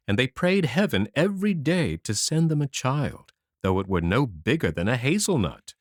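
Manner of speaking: ordinary delivery, nothing marked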